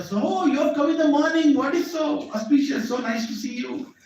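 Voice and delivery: high, delighted welcome from the host; delighted king. Retold or imitated. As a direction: greet the guests with a high, happy lift. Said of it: "ask, uh, Vrishabhanu and Kirtida".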